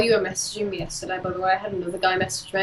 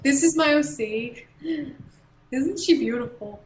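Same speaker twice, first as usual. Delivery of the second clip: mock proud owner; 5 semitones higher than usual. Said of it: "Pretend it's your O C".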